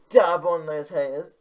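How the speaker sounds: hyped shout. Acted. Vlogger signing off to viewers, loud hype shout. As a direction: belt it like a meme sign-off.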